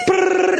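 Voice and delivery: high rolling brrr; trilled brrr sound; rolling noise. Retold or imitated. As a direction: imitate a body tumbling fast. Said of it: "he would go like".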